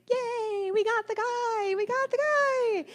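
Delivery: put-on very high, gleeful child voice; sing-song it like proud little kids; cheering kids. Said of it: "the kids were like".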